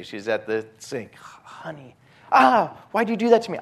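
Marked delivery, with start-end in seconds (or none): 1.2-1.9 whisper. Retold or imitated. Softly greeting wife at sink. 2.3-3.6 exasperated complaint. Retold or imitated. Wife complains after being startled.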